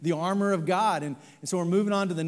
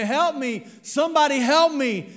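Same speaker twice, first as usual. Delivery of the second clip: higher, louder desperate call; panicked cry for help; 8 semitones higher, 6 dB louder than usual. Acted. Hiker hanging from a tree calling for help, panicked. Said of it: "begins to cry out".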